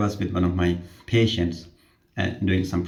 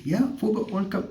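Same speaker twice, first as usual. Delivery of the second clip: higher, mild African-accented imitation; patient confirming; 12 semitones higher than usual. Patient confirming World Cup, mild accented imitation. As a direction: confirm it plainly in his accent.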